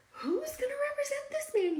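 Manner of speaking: very high, put-on incredulous voice; exaggerated high-pitched rhetorical question; mock questioner. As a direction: squeak out the audience's question in mock wonder.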